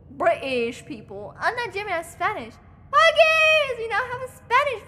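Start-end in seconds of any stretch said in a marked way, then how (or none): 2.9-4.9 high, loud squeal; excited cheer. Real, not acted. Streamer cheering a Spanish person in chat, excited. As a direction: squeal it out in delight.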